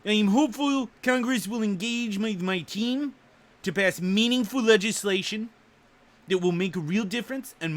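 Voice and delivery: put-on presidential impression, chopped into short phrases; exaggerated politician impression with punched words; Trump. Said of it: "my Trump voice?".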